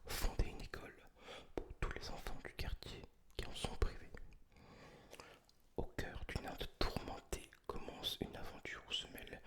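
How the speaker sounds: ordinary talk, nothing marked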